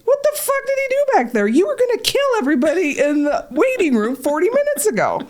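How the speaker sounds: high, disbelieving exclamation; incredulous. Retold or imitated. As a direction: sound stunned at the change. Astonished inner question.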